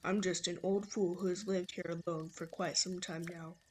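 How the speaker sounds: low-voiced old man; man imitation. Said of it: "my horrible man imitation".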